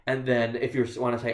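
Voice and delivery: normal talking voice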